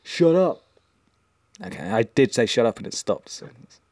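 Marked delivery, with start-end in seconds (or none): 0.0-0.6 high, sharp order to hush; mock scolding. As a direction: playfully tell the ringing phone off. Scolding the ringing phone.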